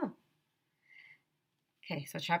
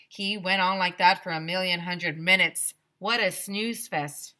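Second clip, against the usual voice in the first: plain reading voice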